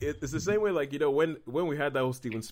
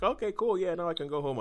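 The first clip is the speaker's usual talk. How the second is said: lightly voiced, higher-pitched breezy satisfaction; casual mock contentment, pitched up; contented moviegoer, mimicked; 7 semitones higher than usual. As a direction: imitate an easily pleased film fan.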